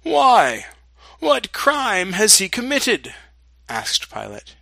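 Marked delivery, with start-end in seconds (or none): 0.0-3.1 raised, higher-pitched challenge; challenging question. A narrator's reading. Pilate questions the shouting crowd, challenging them. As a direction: demand an answer from a crowd.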